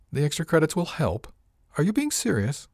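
rising, disbelieving question; incredulous. Acted. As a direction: echo her words in disbelief. Captain repeats her words in disbelief.

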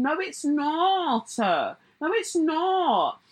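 high, slow, stretched-out denial; drawn-out contradiction. Real, not acted. Answering the singer's claim about always being this way, drawn-out contradiction. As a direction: stretch it high in exasperated disbelief. Mocking rebuttal of acted excuse.

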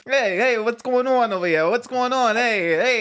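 Mario-style Italian-American hey-what's-going-on; Italian impression. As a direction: call out like a cocky guy from the neighborhood. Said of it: "Let's hear your best Italian impersonation".

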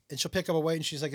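normal talking voice

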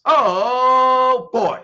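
exasperated shout. Real, not acted. Exclaims at the end of rant.

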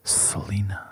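low, breathy sultry voice; low magnetic voice. Pretend. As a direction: purr the name softly. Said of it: "Say, say my name".

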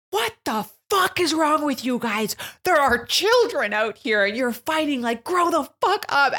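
put-on high, loud scolding of fighting kids; retold angry yelling. Holly telling off fighting boys in the parking lot, angry yelling. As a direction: yell it like an outraged mom telling them off. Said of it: "I was yelling at them".

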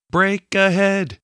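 high, slow called-out warning; shouting from crow's nest. A narrator's reading. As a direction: call it down from the masthead. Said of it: "the lookout called from the crow's nest".

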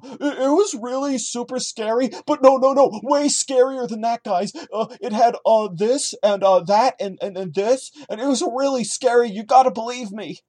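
put-on high, excited babbling kid voice; mock-scared high kid voice. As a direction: squeak it out like a panicky kid who swears it's true. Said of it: "this whole thing just reeks of".

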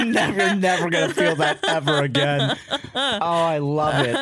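giggling through the words; delighted, laughing while talking; cracking up over the melodramatic chapter ending. Real, not acted.

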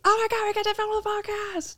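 high, squealed excitement; mock thrilled. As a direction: squeal it like a delighted fan. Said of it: "like a today when we're like".

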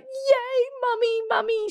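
put-on high toddler voice cheering for mummy; sleepy toddler. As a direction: squeal it like a two-year-old just woken. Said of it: "she was like".

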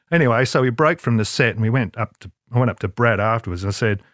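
ordinary delivery

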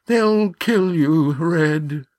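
high, slow, pleading whimper. A narrator's reading. Yan warns Red he'll be killed, whimpering. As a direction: whimper it with drawn-out words. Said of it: "whimpered Yan".

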